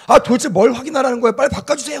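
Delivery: high, rushed, loud customer demand; acted exasperated outburst. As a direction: burst out impatiently as a fed-up customer. Acted customer exploding at the employee. (짜증이 폭발한 고객 연기)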